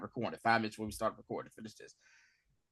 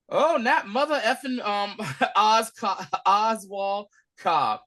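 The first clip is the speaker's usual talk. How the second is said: high, louder, drawn-out name; excited reaction; 9 semitones higher, 7 dB louder, slower than usual. Real, not acted. Host reacting to the show's twist, excited and slow. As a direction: savor the name with glee. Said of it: "reacting excitedly".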